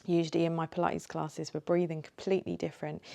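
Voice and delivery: normal talking voice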